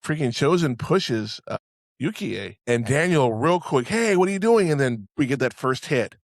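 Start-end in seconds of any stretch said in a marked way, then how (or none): 3.9-4.7 a raised, high "hey" at a shove; startled shout. Retold or imitated. Daniel yelling at Chozen as he is shoved, high alarmed call.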